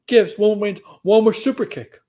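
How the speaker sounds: ordinary talk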